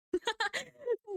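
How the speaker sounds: high, teary burst of laughter; high crying laugh. Real, not acted. Laughing at her own silly voice, high and teary. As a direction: laugh so hard it sounds like crying.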